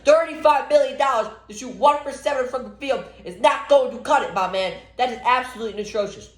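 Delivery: high-pitched indignant outburst; exasperated rant. Real, not acted. Fan berating a highly paid player's shooting, exasperated.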